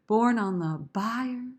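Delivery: slow line, stretched BIER; emphasis on "bier". Shown as a demonstration. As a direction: lift and lengthen the word BIER. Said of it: "really wanna emphasize this idea".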